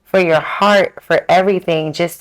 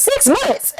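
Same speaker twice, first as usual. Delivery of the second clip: very high, squeaked incredulous question; shocked disbelief. Retold or imitated. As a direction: echo it in comic horror. Her shocked echo of therapist's estimate.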